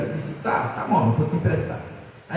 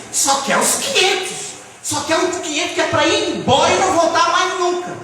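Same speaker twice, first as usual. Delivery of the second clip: fervent preaching; 8 semitones higher than usual. Acted. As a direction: lift the congregation with a fervent sermon. Preaching builds up. (pregação inflamada, mais aguda e forte)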